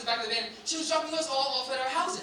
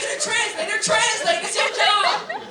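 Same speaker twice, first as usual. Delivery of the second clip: higher, louder, laughing disbelief; mock outrage; 8 semitones higher, 7 dB louder than usual. Comic to the audience, scolding a useless translator in loud disbelief. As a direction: throw up your hands at the translator, laughing.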